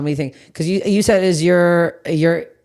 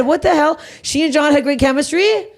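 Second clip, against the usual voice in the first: ordinary talk, nothing marked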